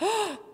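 high shocked gasp. Retold or imitated. Methodist congregation startled by an amen, a shocked gasp. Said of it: "the Methodists responded with what?".

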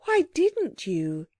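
high, slow shaky question; rather shaky. A narrator's reading. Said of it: "her voice was still rather shaky".

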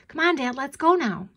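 put-on child pestering to get going; impatient child. A narrator's reading. Mandy tugging her father's jacket, impatient.

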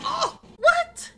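high startled what; shocked. Real, not acted. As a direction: caught off guard, yelp it. Shocked reaction in game.

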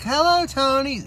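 high, slow, sing-song greeting; bimbo clone wives. Retold or imitated. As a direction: coo it like a vacant trophy wife. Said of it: "in unison".

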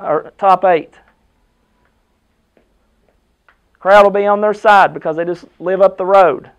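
loud, fired-up coach speech; rally the team before the game; halftime pep speech. Acted.

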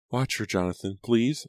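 lightly voiced mother asking a favour; mother's request. A narrator's reading.

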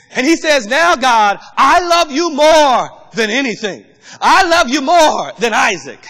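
loud, fervent preaching build. Acted. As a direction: preach it with rising fire. Preaching at full force.

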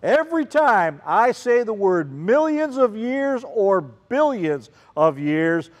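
normal talking voice

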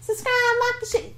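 very high squeaky plug. Pretend. Continues high voice.

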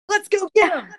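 high, loud mock team cheer; excited rallying cry. Friends rallying to go fetch flowers, excited. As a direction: imitate a gung-ho group charging off.

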